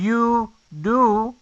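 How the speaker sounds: "you do" drawn out slowly as one smooth word; slow linked "you do". Shown as a demonstration. Teacher stretching you do to show linking. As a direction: glide "you do" together slowly.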